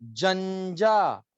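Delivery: जंजा stretched very slowly, syllable by syllable; drawn-out model syllable. Shown as a demonstration. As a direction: drag out जंजा for the class to copy. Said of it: "सारे बोलेंगे".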